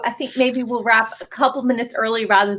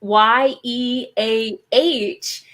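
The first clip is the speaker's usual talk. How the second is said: YEAH spelled slowly, letter by letter; slow, deliberate spelling; much slower than usual. Shown as a demonstration. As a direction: space out each letter, Y, E, A, H. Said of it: "I would say".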